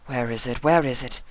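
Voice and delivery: high, searching urgently; frantic Rarity. A narrator's reading. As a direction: rummage and fret aloud.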